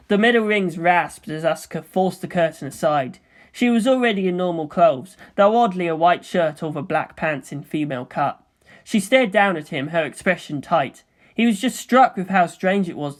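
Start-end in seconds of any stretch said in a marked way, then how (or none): none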